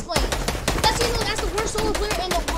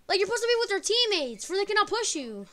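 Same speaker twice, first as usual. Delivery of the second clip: usual speaking voice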